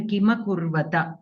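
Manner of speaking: "Kima Kurvata" with full a after m and t; complete final syllables. Shown as a demonstration. Teacher corrects a student, "Kima Kurvata" with makara and takara complete. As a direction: sound every vowel in "Kima Kurvata", endings uncut. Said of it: "should be completely pronounced".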